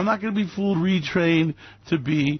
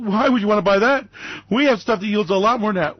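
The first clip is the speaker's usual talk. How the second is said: fast, loud, higher pushy salesman; pushy broker; 5 semitones higher, 3 dB louder, faster than usual. Retold or imitated. Broker dismissing a client, fast loud pushy salesman. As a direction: brush off the client like a slick broker.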